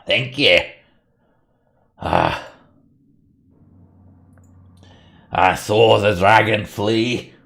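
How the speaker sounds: lightly voiced solemn dwarf, with long pauses; grateful dwarf. Dwarf thanking the party for reclaiming his mine, lightly voiced gratitude. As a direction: give thanks with a touch of weary relief.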